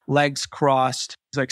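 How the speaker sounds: usual speaking voice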